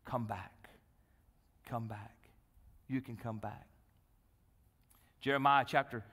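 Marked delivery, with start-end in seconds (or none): 0.0-3.6 very soft, low pleading invitation; tender appeal. Real, not acted. Preacher urging lapsed believers to return, hushed and tender. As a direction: let each phrase sink gently into the pauses.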